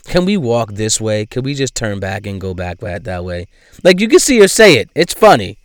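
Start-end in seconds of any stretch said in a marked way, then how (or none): 0.0-3.5 softer, lower, as if muttering to her team; mock hushed request. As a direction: quietly ask to turn back, imitating. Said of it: "She's like".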